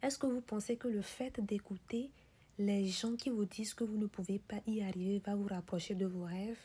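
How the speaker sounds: normal talking voice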